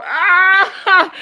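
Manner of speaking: loud, high shriek of laughter; big laugh. Real, not acted. Streamer cracking up at a Wire joke, shrieking laugh. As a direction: let out a real high cackle.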